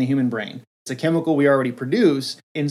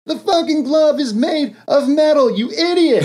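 much higher, louder scornful yell; exasperated Magneto; 12 semitones higher, 5 dB louder than usual. Retold or imitated. Villain scolds Thanos, scornful yell. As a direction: yell in disgust at a fool. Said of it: "He's like".

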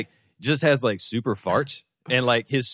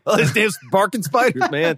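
higher, hammed-up innocent excuse; goofy mock excuse; 6 semitones higher than usual. Spider-Man covering his fart in front of Mary Jane, goofy and higher. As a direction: play dumb about the smell.

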